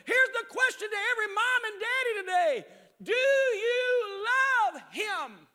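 loud, high, shouting preacher's plea; fiery revival preaching; preaching at full force. Acted. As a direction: preach it hard to the parents.

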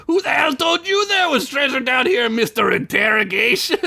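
very high mocking yell; angry, squawking mimicry; yelled, pissed off. A narrator's reading. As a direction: throw the question back in a screechy parody. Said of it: "Gunk yelled, getting more pissed".

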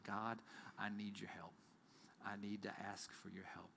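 soft unvoiced pleading whisper; whispered prayer. Believer asking God for help, hushed whisper. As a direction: pray it under your breath.

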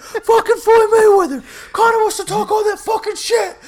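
high, loud hollering fight-fan rant; mock-raging yell. Imitating his friend yelling during the fight, high raging shout. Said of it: "And L J's like".

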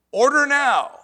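mocking the phone commercial's tagline; mock ad announcer. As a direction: bellow it like a TV pitchman. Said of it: "It said".

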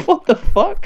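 high-pitched stunned what the fuck; shocked outburst. Retold or imitated. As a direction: squeal it in disbelief. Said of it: "And I'm like".

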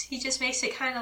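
ordinary talk, nothing marked

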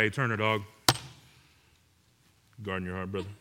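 low, soft, laid-back bro voice; bro talk. Retold or imitated. Preacher mimicking greeting his friend Turner, low casual bro. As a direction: drop low like a casual buddy. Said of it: "walk up to Turner and be like".

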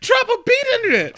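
very high squealed exclamation; mock-hysterical high wail; hyped-up reaction. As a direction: shriek it like a hype man. Excited reaction to Rick's rap line.

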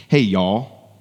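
a light Southern "Hey, y'all", drawn warmly; Southern greeting. Shown as a demonstration. Pastor greeting the congregation the Southern way, lightly drawled. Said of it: "to use the Southern phrase".

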